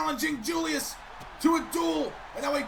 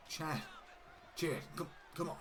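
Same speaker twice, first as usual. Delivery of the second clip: very soft, low pleading; timid pleading; 7 semitones lower, 14 dB quieter, faster than usual. Acted. Otis pleading with furious Gable, very soft. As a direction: plead softly to calm him.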